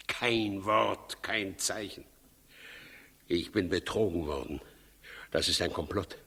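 brooding mutter. Acted. Herodes brooding over the missing magi, low mutter. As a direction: sink into suspicion, half to himself.

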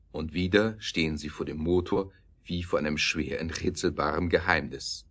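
usual speaking voice

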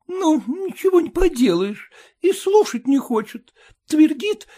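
put-on high, weary old mother; mother-in-law. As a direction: complain in resignation. Mother-in-law's voice.

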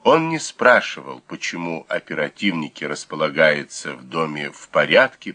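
usual speaking voice

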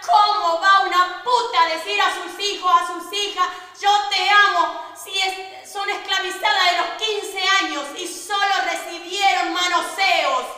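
loud, impassioned question; asking aloud. Retold or imitated. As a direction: demand it of the room, full voice. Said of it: "yo pregunto en voz alta". (pregunta en voz alta, apasionada)

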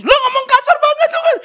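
loud, high-pitched tearful complaint, imitated; hammed-up crying protest; mock crying. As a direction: wail it like a wronged girlfriend. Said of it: "sering nangis itu".

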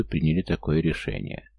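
plain reading voice

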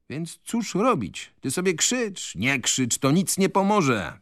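a slow, high shrug of philosophy; philosophizing. A narrator's reading. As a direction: shrug it off like a sage. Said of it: "filozofował Koruta".

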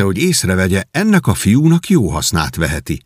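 ordinary delivery

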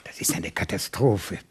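low, despairing whisper. Acted. Director whispers his despair beside the inspector, hushed and low. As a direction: breathe the words in dismay under your breath.

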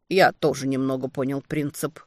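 usual speaking voice

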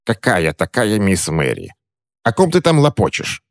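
scoffing at his babble; puzzled crone. Acted. Witch puzzled, mocking.

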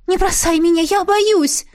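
high, loud frightened plea; cling and beg, terrified; frightened pleading. Acted. Girl clinging, begging in fear.

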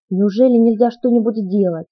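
mildly agitated, anxious question. A narrator's reading. Cullen asks if anything can be done, agitated. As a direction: ask with a touch of alarm. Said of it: "а потом взволнованно спросил".

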